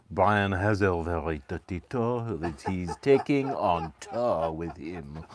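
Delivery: slow, hammed-up French narrator; French accent. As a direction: tell it saucily in a put-on French accent. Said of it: "You wanna do your French accent".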